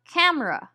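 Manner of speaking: "camera" as two syllables, CAM-ruh; dropped schwa syllable. Shown as a demonstration. Teacher giving another casual-speech example, schwa dropped. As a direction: say "camera" with the middle vowel gone. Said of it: "may disappear completely in casual speech".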